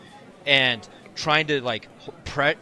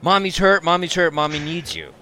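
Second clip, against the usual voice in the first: put-on child yelling; 5 semitones higher, 8 dB louder than usual. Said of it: "I could hear Asa yelling".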